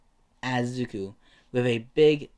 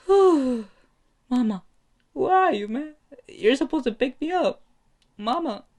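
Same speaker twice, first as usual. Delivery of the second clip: put-on very high, childlike plea; hammed-up panicky whine, pitched way up; whimpering for mama; 13 semitones higher, 4 dB louder than usual. Frightened bully calling for his mother to come, whiny.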